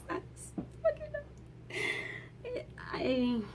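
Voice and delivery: words breaking off in tears; choked up. Real, not acted. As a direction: voice cracking into a high, soft sob. Choked up over Spider-Man's death.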